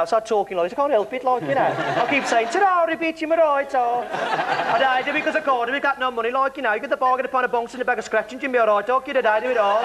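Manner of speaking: high, lively Brummie impression; Birmingham accent. Shown as a demonstration. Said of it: "at the moment I'm in Birmingham".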